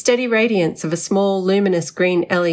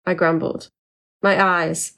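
ordinary delivery

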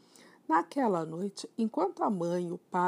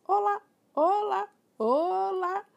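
high, loud squawking parrot greeting; papegaaienstem; 13 semitones higher, 8 dB louder, slower than usual. Acted.

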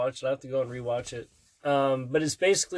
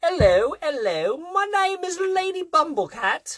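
high-pitched, terribly fake British accent; hammed-up British greeting, pitched way up; bad British accent; 13 semitones higher, 3 dB louder than usual. Parent introducing Lady Bumblecat, bad high British accent. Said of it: "worst British accent".

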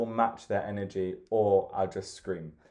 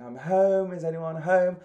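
calling out; 7 semitones higher, slower than usual. Retold or imitated. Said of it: "came into the house shouting".

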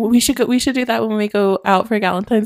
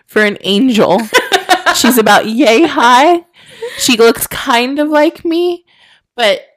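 laughing, slow pretend drunk plea; mock drunk sister; 5 semitones higher, 5 dB louder, slower than usual. Pretending to ask a bouncer for her lost sister, laughing. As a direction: play a tipsy girl begging the bouncer, giggling. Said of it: "if I was drunk on Mill Avenue".

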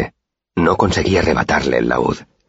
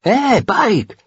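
calling out. A narrator's reading. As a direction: shout across to get Pike's attention. Boy calls out to Pike.

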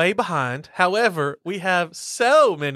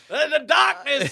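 sketch impression; 8 semitones higher than usual. [name] sketch impression.

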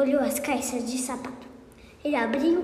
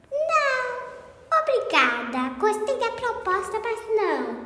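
sassy refusal; 8 semitones higher, slower than usual. Acted. Thief refuses arrest.

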